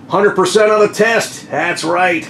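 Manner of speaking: high-pitched, pleased announcement; proud boast. Real, not acted. Showing viewers his old test score, proud boast. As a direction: crow about it, voice lifted.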